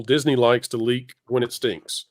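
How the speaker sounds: normal talking voice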